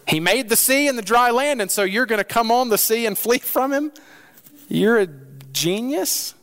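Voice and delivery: mock him for fleeing; mocking sailors. Sailors mocking Jonah for running from God, sarcastic.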